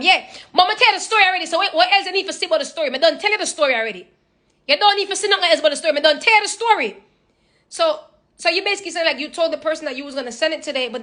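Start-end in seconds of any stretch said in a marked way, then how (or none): 0.0-7.0 fast, high Jamaican patois scolding; a Jamaican mom fed up with questions; impatient Jamaican mom. Retold or imitated. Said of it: "No, no, mommy".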